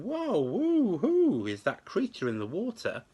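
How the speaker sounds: amazed. A narrator's reading. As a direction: gasp in wonder at a stranger. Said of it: "he asked them".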